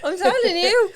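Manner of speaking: high, loud laughing words; real laughter agreeing with a friend. Real, not acted. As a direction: laugh through it. Laughing about busy Sundays.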